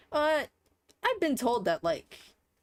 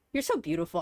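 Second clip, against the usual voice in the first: ordinary delivery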